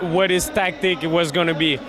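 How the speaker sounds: usual speaking voice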